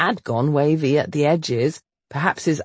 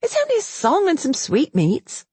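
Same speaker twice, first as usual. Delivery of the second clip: higher, mildly pleading; lightly voiced heroine; 12 semitones higher than usual. Governess to angry heir, higher and mild. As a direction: make it sound small.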